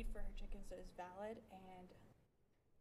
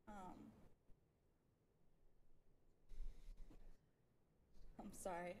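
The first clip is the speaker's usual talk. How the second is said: nervous, hesitant speech; shaky nervous public comment; stage fright; 4 dB quieter than usual. Real, not acted. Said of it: "I'm a little nervous".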